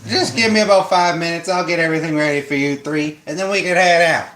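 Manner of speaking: cheery hillbilly driver twang. Acted. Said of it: "You like my hillbilly voice?".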